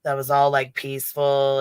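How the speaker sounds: normal talking voice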